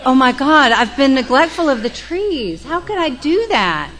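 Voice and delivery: high, loud hammed-up alarm over the trees; mock panic. Joe mocking her own worry about the trees, hammed-up alarm. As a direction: ham up a guilty panic. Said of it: "That's really what I thought".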